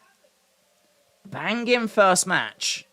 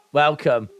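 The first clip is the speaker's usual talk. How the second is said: usual speaking voice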